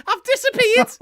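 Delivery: very high, loud Scouse yell; Mike Myers. Retold or imitated. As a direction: yell it as a squawking Scouse slasher. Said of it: "dubbed over a Scouse accent".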